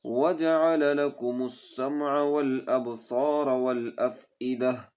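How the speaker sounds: melodic chanted tajweed recitation; Quran recitation. Shown as a demonstration. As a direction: recite slowly and musically, stretching vowels. Teacher recites Quran verse.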